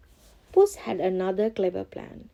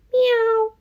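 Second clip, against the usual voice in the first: high loud meow; cat meow; 8 dB louder than usual. Acted. Cat pounces on the mouse, loud high meow.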